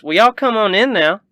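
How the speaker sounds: high, loud country hostess; lively cowgirl voice, admiring; friendly Southern rancher woman, impressed. Acted.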